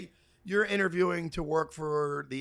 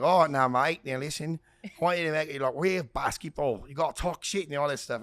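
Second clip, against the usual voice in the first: put-on lower-pitched, clumsy Australian accent; bad Australian accent; 5 semitones lower than usual. Australian director coaching a costumed actor, bad Australian accent. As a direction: imitate an Australian director, badly. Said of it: "in my bad accent".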